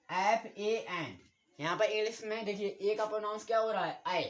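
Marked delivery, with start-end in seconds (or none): 0.1-1.2 letters spelled slowly one by one; slow spelling out. Shown as a demonstration. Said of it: "यहाँ पर देखिए".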